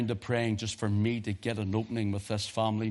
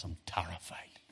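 hushed terrified; 3 semitones lower, 8 dB quieter than usual. Retold or imitated. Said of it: "He says".

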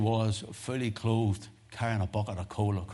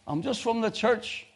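high, slow calling out; retold shout; 13 semitones higher than usual. Preacher shouting to the unseen householder, high drawn-out call. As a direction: call through a closed door. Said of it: "I shouted".

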